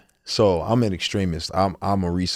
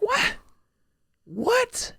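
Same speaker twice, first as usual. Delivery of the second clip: very high, incredulous double what; high-pitched stunned what; stunned disbelief; 4 dB quieter than usual. Retold or imitated. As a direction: gasp it out as if floored. Retold stunned reaction in therapy.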